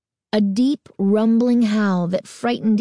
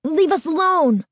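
put-on high, pleading boyish cry; scared boy, pitched high; frightened cry; 9 semitones higher than usual. A narrator's reading. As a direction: cry out to be left alone. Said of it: "the other cried".

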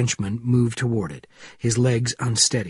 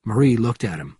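usual speaking voice

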